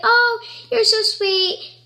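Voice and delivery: high, drawn-out coo; gushing. Retold or imitated. Greeting someone's dog on meeting it, gushing. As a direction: coo over an adorable dog. Gushing to dogs she meets.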